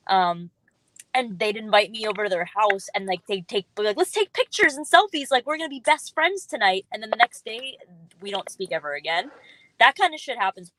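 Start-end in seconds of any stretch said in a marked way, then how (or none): 4.0-6.9 high-pitched, imitated party enthusiasm; mock gushing. Party acquaintance to new best friend, mock gushing.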